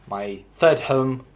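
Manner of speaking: ordinary delivery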